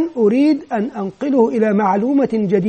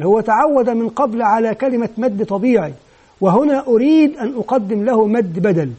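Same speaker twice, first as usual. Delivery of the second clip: ordinary talk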